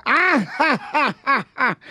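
a hint of a cackling hen; mock the crocodile with a hammed-up ja ja; mocking hen laugh. Hen in the joke laughing at the crocodile, light mock cackle. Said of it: "la gallina se burla".

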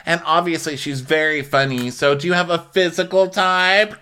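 high-pitched probing questioner; Kenya imitation. Kenya quizzing John on his type, high imitation. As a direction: quiz him in a high put-on voice.

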